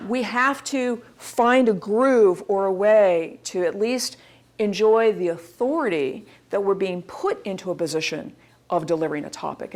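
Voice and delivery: ordinary talk, nothing marked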